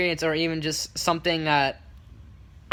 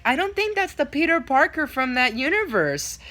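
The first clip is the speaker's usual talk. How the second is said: higher-pitched correcting mom voice; imitated mom; 8 semitones higher than usual. Mom correcting her son about Spider-Man, imitated. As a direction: gently set the kid straight, voice raised in pitch. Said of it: "the mom's like".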